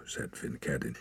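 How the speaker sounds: ordinary delivery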